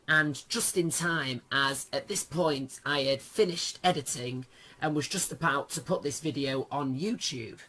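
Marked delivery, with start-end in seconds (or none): none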